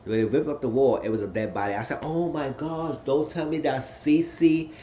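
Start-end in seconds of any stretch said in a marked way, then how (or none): 2.0-4.7 oh my gosh, said higher and slowly; shocked disbelief, drawn out. Real, not acted. Shocked reaction to the body.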